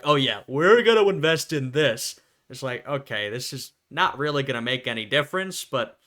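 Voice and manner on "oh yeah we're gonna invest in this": louder mock eager leader; gung-ho mock leader voice; eager Nazi leaders. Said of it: "Hitler and his boys were like".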